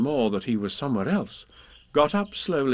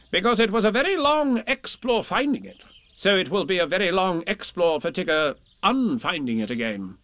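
high, emphatic reasoning voice; 7 semitones higher than usual. Acted. Rabbit justifying the North Pole plan, confident. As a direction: explain it as obvious logic.

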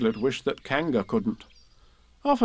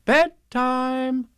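high, loud bedtime sing-out; motherly call; 13 semitones higher, 5 dB louder than usual. A narrator's reading. Kanga announcing bedtime to pretend Roo, motherly call. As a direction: draw it out for a little one.

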